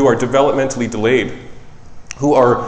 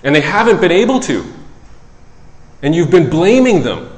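louder and higher, pressing each point hard; harsh rebuke; 5 semitones higher, 5 dB louder than usual. Retold or imitated. Psychologist confronting parents, loud and pressing. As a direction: lay it on the parents firmly. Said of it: "I don't say it that harshly".

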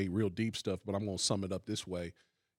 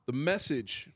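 ordinary talk, nothing marked

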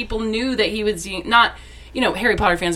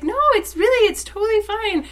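much higher, sweet, breezy reassurance; lightly voiced Summer Glau; 12 semitones higher than usual. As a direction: brush off the apology kindly. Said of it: "And she's like".

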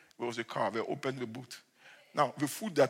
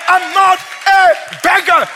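high, loud and slowed right down; slow, drawn-out shout; 19 dB louder, slower than usual. Acted. Pastor repeating to the church he is no beggar, slow and shouted. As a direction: stretch every word out as you shout.

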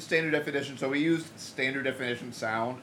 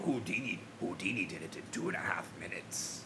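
low, soft put-on villain voice, far below his own; 9 semitones lower, 12 dB quieter than usual. To friends, quoting the genie's taunt in a deep villain voice. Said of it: "was like".

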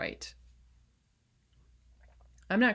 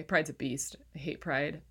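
ordinary delivery

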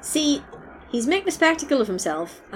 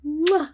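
kiss noise; 8 semitones higher than usual. Retold or imitated. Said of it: "And kisses him".

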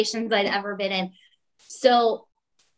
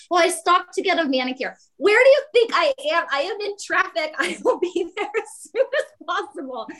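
higher, mock sarcastic reply; 9 semitones higher than usual. To Mark's assistant, a high sarcastic answer. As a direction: snark back at the caller. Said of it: "I was like".

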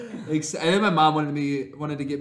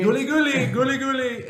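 high gibberish chant; Simlish gibberish; 9 semitones higher, 4 dB louder than usual. Pretend. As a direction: babble like a Sim. Echoing the gibberish.

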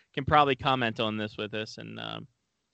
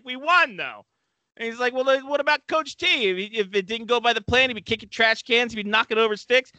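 higher, louder mock player protest; whiny player retort; 8 semitones higher, 3 dB louder than usual. Player arguing back at the coach, high mock whine. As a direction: squeak out a defensive teen comeback.

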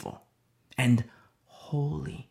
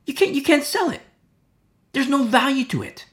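scornful; 10 semitones higher than usual. Real, not acted. Mocking worthless signature.

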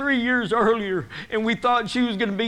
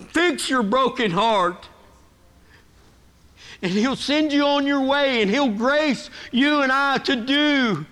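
raised, emphatic sermon delivery; preaching; 4 semitones higher, 4 dB louder than usual. Real, not acted. Pastor urging congregation to break toward God, emphatic preaching.

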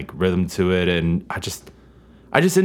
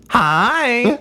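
super high, drawn-out squeaky hi; high pitched hi; 13 semitones higher than usual. Pretend. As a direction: sing out a very high hi. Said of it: "Was that one high enough for you".

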